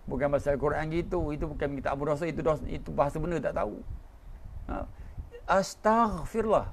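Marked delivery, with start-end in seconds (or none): 5.5-6.7 slow "Astaghfirullah" with a drawn-out, exact gh; careful pronunciation. Shown as a demonstration. Preacher correcting the congregation's recitation. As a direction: spell "Astaghfirullah" out slowly and precisely. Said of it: "Bukan astaghfirullah".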